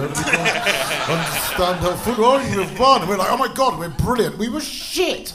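ordinary talk

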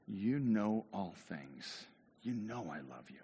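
very slow, hushed declaration; earnest plea. Acted. Peter answers Jesus the third time, slow and earnest.

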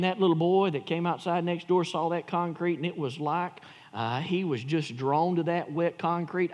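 ordinary talk, nothing marked

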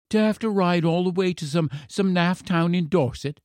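put-on high-pitched sulky child; complaining boy. A narrator's reading. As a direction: grumble as a put-upon little boy. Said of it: "said the boy".

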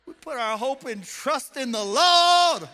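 loud, slow, ringing declaration; preaching shout. Real, not acted. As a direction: shout the faith over the crowd. Preacher proclaiming at full force.

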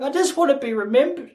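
high-pitched imitation of a crying wife; mock bawling. Hurt wife to forgetful husband, mock bawling. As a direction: wail it comically high, as if in tears. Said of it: "sound like you're bawling your eyes out".

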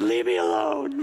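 high, whiny leave me alone; mock whiny plea. Dad hiding in the bedroom, hammed-up high whine. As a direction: play the worn-out dad begging for peace.

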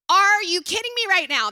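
put-on loud exasperated outburst at squabbling kids; exasperated yell. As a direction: blurt it out like the last straw. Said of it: "and you're just like".

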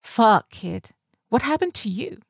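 put-on London accent, taken aback; concerned Londoner addressing a kid; Rose. A narrator's reading. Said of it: "her too chic for this place London accent".

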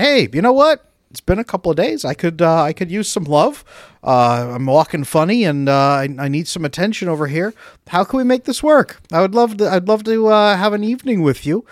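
a husband nudging for attention, half joking; hopeful husband. Retold or imitated. Husband asking wife for intimacy.

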